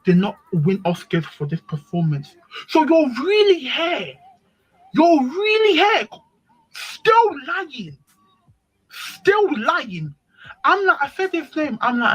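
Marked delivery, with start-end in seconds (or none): 2.7-10.1 disbelieving. Retold or imitated. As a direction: throw his lie back at him. Confronting the lying boyfriend.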